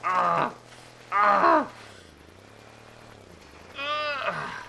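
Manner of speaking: short high yelps, then a longer cry; sudden high-pitched screams mid-game. Real, not acted. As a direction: scream loudly in alarm, then easing. Screams as game goes wrong.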